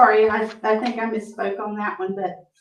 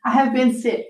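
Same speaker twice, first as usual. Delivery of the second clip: usual speaking voice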